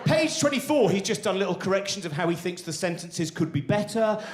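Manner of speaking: ordinary talk, nothing marked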